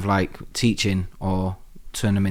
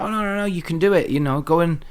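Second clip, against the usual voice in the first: higher-pitched quoted reassurance; retold pep talk, pitched up; encouraging ex-girlfriend; 7 semitones higher than usual. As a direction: lightly imitate her urging him on. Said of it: "And she was like".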